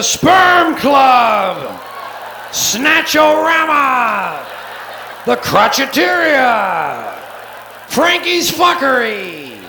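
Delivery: gleeful shouting announcer; shouted bar names. Acted. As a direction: bellow them like a neon barker.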